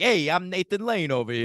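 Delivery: hammy New York accent impression. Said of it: "trying to do, like, a New York accent".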